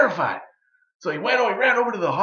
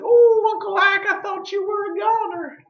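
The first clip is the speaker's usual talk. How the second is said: put-on high, louder old woman's cry of relief; relieved granny voice; 5 dB louder than usual. Granny Beecher finding Uncle Ike alive, high relieved voice. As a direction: squeal it out like a frantic granny. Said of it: "She said".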